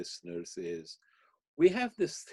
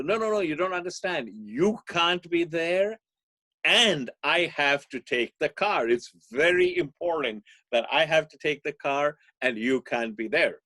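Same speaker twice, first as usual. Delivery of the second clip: put-on insistent teen; 5 semitones higher, 7 dB louder than usual. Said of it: "son insists on taking car".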